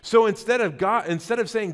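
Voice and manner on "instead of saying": normal talking voice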